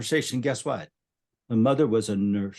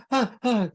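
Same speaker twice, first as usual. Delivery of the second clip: high, panicky wail imitating the mother; mock hysterical cry; 11 semitones higher than usual. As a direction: squeal it out like a frantic mother. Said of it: "just hysterical".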